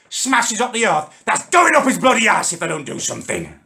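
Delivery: ordinary delivery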